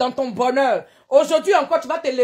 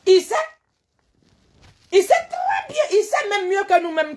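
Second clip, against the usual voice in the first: high shouted insistence; 7 semitones higher than usual. Real, not acted. Scolding the ex-president, in a shout. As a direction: hammer it home at full voice.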